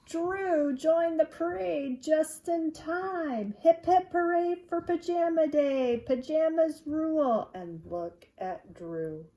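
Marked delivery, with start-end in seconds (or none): 0.1-3.5 high, slow, building announcement; triumphant storytelling. A narrator's reading. 3.6-7.5 high, loud hooray; celebratory chant, pitched up; class cheer. Acted.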